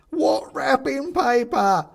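very high, slow squeaky toy; creepy toy chorus voice. A narrator's reading. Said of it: "says a voice".

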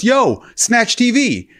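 drawn-out high call of "Yo, Snatch T V."; slow, high hail across the beach. Retold or imitated. Rapper spots him on Venice Beach and calls out slowly. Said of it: "He goes".

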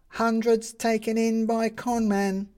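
high, slow headline cry; newsboy calling headlines. Acted.

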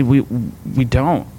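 "We, we don't" drawn out slowly and settled. Shown as a demonstration. Pastor answering believers who demand answers, slow and settled. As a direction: let "We, we don't" sink in slowly. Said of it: "I just say".

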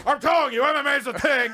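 high, loud mock Louis voice. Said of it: "Louis is like".

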